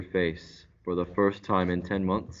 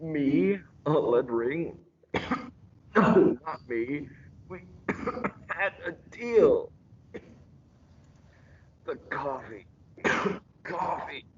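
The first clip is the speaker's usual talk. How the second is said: choking out the words between coughs, strained and high; poisoned; 6 semitones higher than usual. Acted. Poisoned host chokes out protests to his killer, high and strained. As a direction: fight for every word as poison takes hold.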